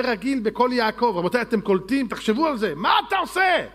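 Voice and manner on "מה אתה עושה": much higher, louder challenging voice; incredulous challenger. Retold or imitated. Questioner rebukes Jacob, higher and louder. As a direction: scold Jacob for not faking.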